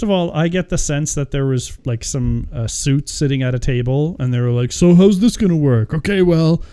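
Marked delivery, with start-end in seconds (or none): 4.7-6.6 louder, higher mock boardroom pitch; pitching movie executive. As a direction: act out a suit selling a silly idea. Acting out executives pitching the plot.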